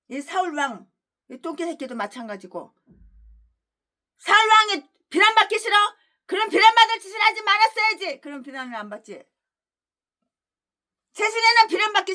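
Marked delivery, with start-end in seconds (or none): 4.2-8.2 high, fast scolding; mock them, then slam the verdict; taunting rebuke. Real, not acted. Preacher taunting critics who hate blame.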